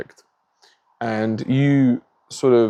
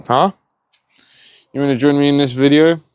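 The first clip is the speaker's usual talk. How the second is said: higher, louder, quicker question to a pet; calling the cat; 5 semitones higher, 5 dB louder than usual. Real, not acted. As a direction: call over to the cat, pitch lifted. Calling his cat over to him.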